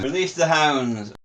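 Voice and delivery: high, drawn-out call; high-pitched, stretched mock order; mock command. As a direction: call it out slowly like a playful command. Jokey catchphrase called out.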